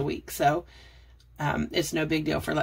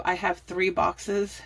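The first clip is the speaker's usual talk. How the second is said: ordinary talk, nothing marked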